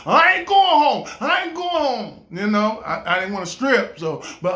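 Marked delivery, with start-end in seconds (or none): 0.1-2.3 loud, high declaration "I ain't going home"; defiant vow. Retold or imitated. To himself about living with mom, a loud defiant vow. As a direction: plant your feet and refuse. Vowing not to move back home.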